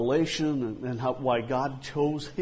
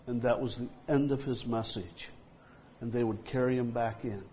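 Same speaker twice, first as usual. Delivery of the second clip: normal talking voice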